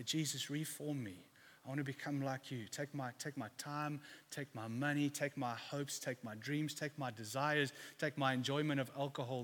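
retold quiet prayer of surrender; hushed prayer. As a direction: pray it low and soft, item after item.

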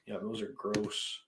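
ordinary delivery

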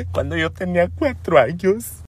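imitating herself sobbing out a story; hammed-up weepy confession; mock crying. Telling the neighbour her own dramas, mock crying. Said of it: "empecé a llorar de mis dramas".

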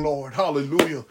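ordinary delivery

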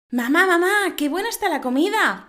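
put-on high, loud little girl calling her mother. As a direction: play an eager child praising dinner. Child voice in a joke.

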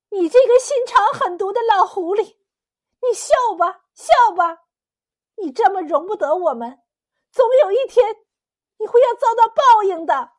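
high, loud tirade hurled at the sky; angry cursing. A narrator's reading. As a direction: curse them out at full voice. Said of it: "白鹤仙子向天空骂道".

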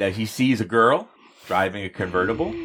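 normal talking voice

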